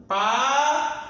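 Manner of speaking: "Paraguai" with U, A and I all sounded in "guai"; careful pronunciation. Shown as a demonstration. Teacher modeling "Paraguai" for exam students, U, A and I all clearly voiced. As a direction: spell out "Paraguai" so the tritongo "guai" is heard. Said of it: "eu pronunciei o U, o A e o I quando eu falo Guai".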